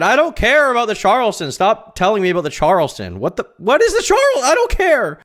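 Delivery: loud, high-pitched rant at chat; exasperated yelling. Real, not acted. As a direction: yell it in mounting exasperation. Exasperated outburst at chat's Charleston talk.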